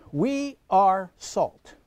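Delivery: very slow, weighing each word in "we are salt"; emphatic stress. Real, not acted. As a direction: spell out "are" slowly and deliberately. Preacher contrasts are with have.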